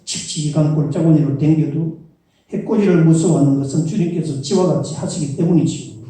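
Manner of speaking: put-on Psalm 23 in a Chungcheong accent, playful; Chungcheong dialect. Pastor reading a dialect version for fun, playful Chungcheong accent. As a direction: read it in a lilting Chungcheong dialect. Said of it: "충청도 버전이에요".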